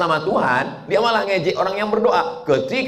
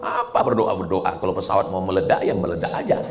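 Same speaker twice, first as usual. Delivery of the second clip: lower, dismissive scoff of a doubter; mocking unbeliever; 9 semitones lower than usual. Preacher voices the unbeliever mocking passengers who pray, lower dismissive scoff. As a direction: brush off the praying people with mild scorn. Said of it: "ngejek sama orang yang berdoa".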